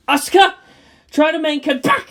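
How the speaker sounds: loud high urging; urgent call. Acted. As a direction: shout instructions in battle.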